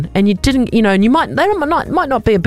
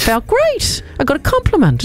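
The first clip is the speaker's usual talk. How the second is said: excited; 11 semitones higher than usual. Real, not acted. Gushing over a compliment.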